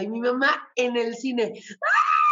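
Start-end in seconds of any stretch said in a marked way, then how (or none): 1.8-2.2 a sharp, very high, louder shriek of outrage; mother's outraged scream. Retold or imitated. Mother in the cinema hearing her daughter is leaving, high shocked shriek. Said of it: "Echando el grito en el cielo".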